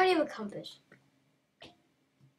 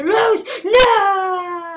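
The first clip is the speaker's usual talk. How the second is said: much higher, louder wail with a sob; real crying shout; 7 semitones higher, 13 dB louder than usual. Real, not acted. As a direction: wail in despair as the game slips away. Losing the game.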